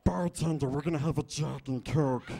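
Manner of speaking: loud, rasping creature voice; hammed-up creature calling to the bartender; Schmiegel. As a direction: growl out the final order. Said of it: "a nice impression of Schmiegel".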